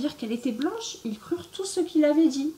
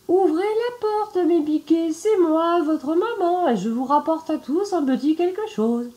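much higher and louder sugary wolf; sweet fake mother voice; 10 semitones higher, 5 dB louder than usual. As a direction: coo it high, pretending to be mum. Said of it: "sa voix devint en effet plus douce".

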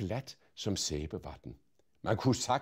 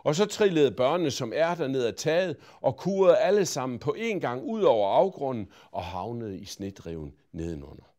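ordinary delivery, nothing marked

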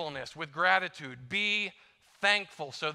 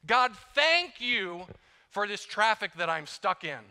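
slow, higher, sarcastic thanks; mock grateful prayer; 5 semitones higher, 5 dB louder, slower than usual. Preacher mocks a prayer for traffic, slow and sarcastic. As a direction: thank God through gritted teeth for the traffic jam. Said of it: "It just becomes automatic".

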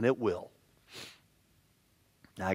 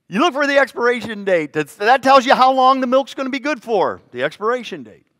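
much higher, loud preacher's cry; shouting the sermon point; preaching at full force; 12 semitones higher, 11 dB louder than usual. Acted. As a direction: drive the point home to the congregation.

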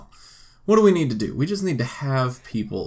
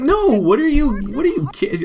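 much higher and louder, indignant; high, loud shocked sim; 13 semitones higher, 7 dB louder than usual. Pretend. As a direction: squeal out an indignant protest. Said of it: "She's like".

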